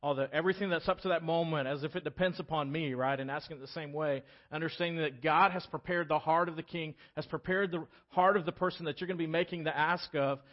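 ordinary talk, nothing marked

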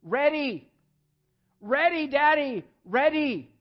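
put-on high, loud little-girl plea; toddler pleading. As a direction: whine it like a two-year-old wanting out. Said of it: "she kept saying to me".

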